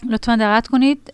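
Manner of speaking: ordinary delivery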